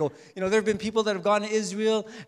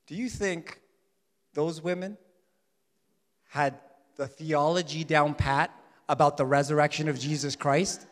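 ordinary talk

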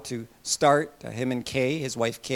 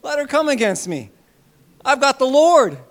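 higher, louder boast of faith; higher, louder, fearless believer; bold confidence; 8 semitones higher, 8 dB louder than usual. Retold or imitated. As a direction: crow it with sure confidence. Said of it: "he'd be like".

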